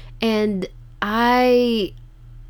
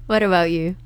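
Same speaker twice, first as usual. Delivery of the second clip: ordinary talk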